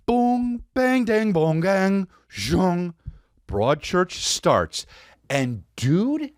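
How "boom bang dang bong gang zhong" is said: high sung-out boom bang bong; vocal sound effects of the intro; imitated theme sounds. Mimicking Broadchurch's opening for listeners, high sound effects.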